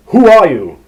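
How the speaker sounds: demanding. A narrator's reading. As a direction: bark the question at an intruder. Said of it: "demanded the stranger".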